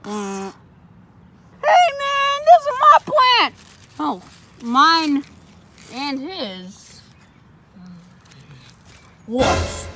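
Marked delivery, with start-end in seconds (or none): 1.6-3.5 very high squeaky protest; squeaky offended. Acted. Octopus defending his plant in a squeaky high voice. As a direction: squeak out your outrage.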